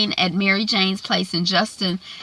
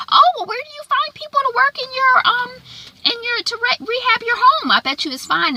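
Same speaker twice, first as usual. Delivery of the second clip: much higher, bright on-air anchor voice; chirpy TV host voice. Retold or imitated. Anchor sweetly questioning her guest on live TV, much higher. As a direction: put on a sugary television smile voice.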